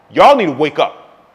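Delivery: ordinary delivery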